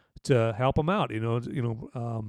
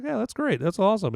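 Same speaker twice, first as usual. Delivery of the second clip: higher, lightly voiced Cooper, breezily upbeat; mock Bradley Cooper; 10 semitones higher than usual. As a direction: shrug it off with mock cheer. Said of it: "And I was like".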